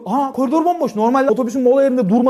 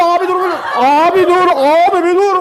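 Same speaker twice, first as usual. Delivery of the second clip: higher, louder pleading; urgent pleading; 5 semitones higher, 5 dB louder than usual. Retold or imitated. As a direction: beg him to stop, getting louder. Uyuyan adamı uyandırmaya çalışıyor. (yüksek sesle yalvarış)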